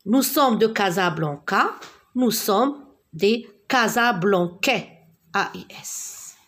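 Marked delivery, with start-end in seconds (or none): none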